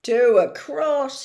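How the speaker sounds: slowing on the count; Hagrid muttering. Acted. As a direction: giant counting bricks to himself. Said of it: "he muttered".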